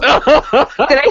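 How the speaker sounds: ordinary delivery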